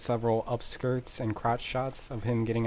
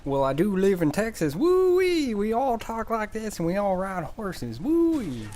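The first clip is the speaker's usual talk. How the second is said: hammed-up Texan accent; 8 semitones higher than usual. Vlogger jokes he lives in Texas, in a hammed-up Texan accent. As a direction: play a cartoon Texan cowboy whooping. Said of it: "I just put an accent on myself".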